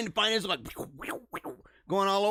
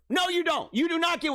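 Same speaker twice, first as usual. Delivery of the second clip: sharp shout; 8 semitones higher than usual. Acted. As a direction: cut them off hard. Ranting at TikToker.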